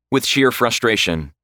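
usual speaking voice

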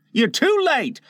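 high-pitched, boastful announcement; proud cry. Acted. Boy boasting to the late arrivals, proud cry. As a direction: crow it proudly, pitched well up. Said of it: "he cried proudly".

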